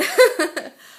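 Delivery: bright burst of laughter. Real, not acted. Laughing at failed recordings.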